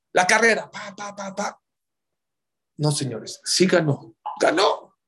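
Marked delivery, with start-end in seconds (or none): 0.7-1.6 running beat. Retold or imitated. Race running sounds. 4.4-5.0 excited high "Ganó"; triumphant. Real, not acted. Triumphant reveal.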